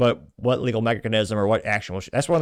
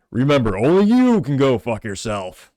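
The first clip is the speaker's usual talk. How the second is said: slow, louder mock ranger voice; Smokey the Bear; 3 semitones higher, 7 dB louder, slower than usual. Mock Smokey the Bear for his viewers, slow and loud. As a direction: boom it out like the park-service bear. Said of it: "a moderately good Smokey the Bear impression".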